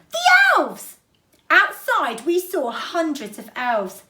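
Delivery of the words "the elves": a sudden, very high, loud cry; shouted. Acted. Child spotting the elves, very high loud shout. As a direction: yell it out as you spot something. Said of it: "shouted one of the children".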